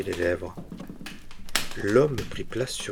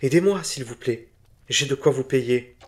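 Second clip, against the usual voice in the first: higher, pleading dealer; a dealer begging a witch for help; 5 semitones higher, 5 dB louder than usual. Acted. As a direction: beg her for help, offering payment. Bourdet pleading.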